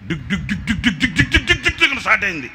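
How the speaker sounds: fast laughing dik dik engine sound; engine noise imitation. Imitating the generator chugging to life, fast laughing sound. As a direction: rattle it off like an engine.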